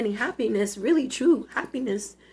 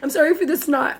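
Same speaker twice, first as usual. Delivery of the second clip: crying apology; 4 semitones higher, 4 dB louder than usual. Real, not acted. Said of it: "I'm not sorry for crying".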